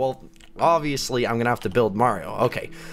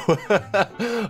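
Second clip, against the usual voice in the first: giggle; 9 semitones higher than usual. Real, not acted. Reacting to a funny build.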